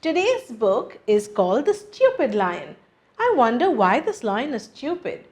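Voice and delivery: ordinary delivery, nothing marked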